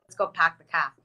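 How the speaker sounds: playful Boston impression, pahk the cah; a light mock Boston accent. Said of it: "My parents are from Boston".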